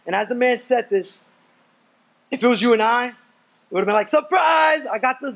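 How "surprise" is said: raised, sing-out reveal; playful surprise. Pretend. Preacher imagines revealing himself to the men, playfully excited. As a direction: call it out like springing a surprise.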